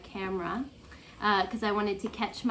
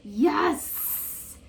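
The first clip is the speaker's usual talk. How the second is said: excited; 5 semitones higher than usual. Real, not acted. Realizes which book it is.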